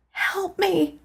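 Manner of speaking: high breathy plea for help; gasped plea. A narrator's reading. Dreamer gasping for help on the floor. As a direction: gasp it out in pain. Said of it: "I gasped".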